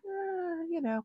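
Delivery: soft, offhand mimicry of a witness; nonchalant. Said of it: "She was very nonchalant".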